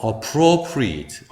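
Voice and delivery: appropriate slowly, starting on a weak "uh"; schwa first syllable. Shown as a demonstration. Teacher modelling the adjective, slow appropriate with weak opening schwa. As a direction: stretch appropriate out after a reduced first vowel. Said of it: "강세가 없기 때문에 슈아인 어로".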